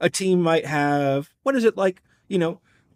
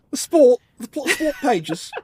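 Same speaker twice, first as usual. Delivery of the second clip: playful mock British accent, pitched higher; fake English accent; 9 semitones higher than usual. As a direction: tease her with a hammed-up British accent. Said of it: "My fake English accent friend".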